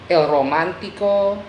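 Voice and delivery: "romántico" in Spanish, roh-MAHN-tee-koh; Spanish pronunciation. Shown as a demonstration. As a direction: say "el romántico" as Spanish, stressing "mán". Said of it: "just add an O".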